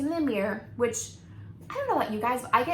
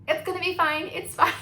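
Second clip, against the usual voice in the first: higher, laughing through fine; nervous laughing insistence; 8 semitones higher, 5 dB louder than usual. Retold or imitated. Bride reassuring the receptionist, nervous laughing. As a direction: brush it off with a laugh.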